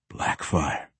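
word let out on a long breath; low, airy sigh of a word; deep exhale. A narrator's reading. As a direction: breathe the name out, low and soft. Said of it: "said with a deep exhale".

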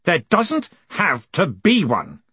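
deliberate tyrant's put-down; commanding retort. A narrator's reading. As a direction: spell it out slowly from on high. Tyrant snubs the challenge.

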